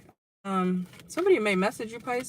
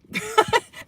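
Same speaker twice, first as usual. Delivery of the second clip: relieved laugh; 7 dB louder than usual. Real, not acted.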